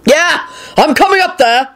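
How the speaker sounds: high, urgent yell. A narrator's reading. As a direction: yell upward in alarm. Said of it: "Quentin yelled".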